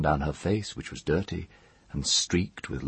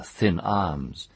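ordinary delivery

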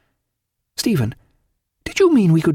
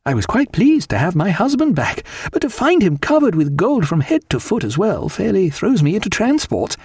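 much higher, delighted wife; overjoyed; 8 semitones higher than usual. A narrator's reading. Wife thrilled by husband's fortune, high and rapturous. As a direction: soar up in pitch with joy. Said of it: "heart is quite thumping with happiness".

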